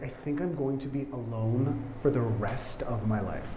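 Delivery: soft, subdued confession; quietly despondent. Acted. Crying boy confiding in his roommate, quietly despondent. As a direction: confide it low in volume, resigned.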